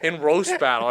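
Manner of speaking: high, fast angry taunting. Retold or imitated. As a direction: challenge him like a sore loser. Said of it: "he was getting so pissed".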